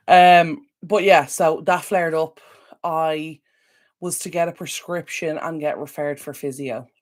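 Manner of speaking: ordinary talk, nothing marked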